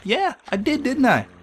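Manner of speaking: put-on very high surprised kid; surprised squeaky voice. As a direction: squeak it out in disbelief. Kurt surprised by himself.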